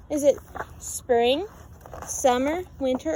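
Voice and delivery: ordinary delivery, nothing marked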